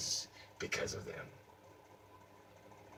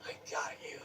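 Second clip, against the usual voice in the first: "I got you" breathed out unvoiced, much softer; whispered gloat; 2 semitones higher, 14 dB quieter, faster than usual. Savoring the win over the mime, a whispered "I got you". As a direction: hiss "I got you" as a private victory.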